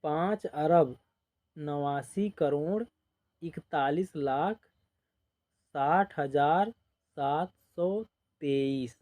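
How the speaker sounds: slow, place by place read aloud; slow dictation. Shown as a demonstration. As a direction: read each place value slowly for the class. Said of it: "इसको ऐसे पढ़ेंगे". (धीरे-धीरे अंक पढ़कर बताना)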